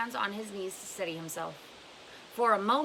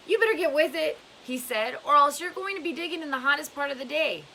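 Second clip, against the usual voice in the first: louder and higher, barking a warning; gruff warning; 7 semitones higher, 7 dB louder than usual. Acted. Mister Sir warns Stanley to keep digging, loud. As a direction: push the boy to dig, raised voice.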